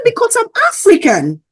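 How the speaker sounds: high, loud, emphatic retold answer; imitating him declaring it loudly; imitated insistent man. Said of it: "He said".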